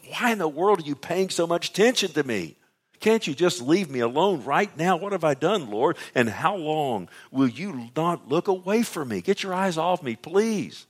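high-pitched, insistent protest; exasperated pleading. Acted. Preacher as Job begging God to look away, exasperated. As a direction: plead upward in mounting exasperation.

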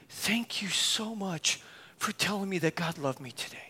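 whispered thanks, soft and choked; tearful whisper. Retold or imitated. Girl in tears thanks the preacher, whispered. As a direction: breathe it out, barely voiced.

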